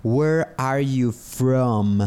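slow, clear "Where are you from?" for learners; patient classroom model, slow and even; slow teaching model. Shown as a demonstration. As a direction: spell out "Where are you from?" slowly, word by word.

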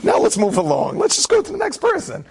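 high mock inner voice pushing on; host's unheard inner voice urging himself on, high and mock. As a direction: squeak at yourself to move along. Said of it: "I don't get certain cues to just be like".

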